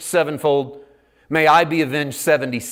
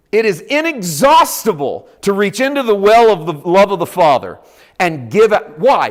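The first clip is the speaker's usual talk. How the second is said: ordinary talk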